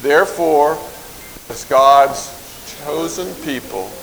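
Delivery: slow, word groups set apart by pauses; slow recitation. Shown as a demonstration. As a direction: say it slowly so others can follow. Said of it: "I will say it slowly".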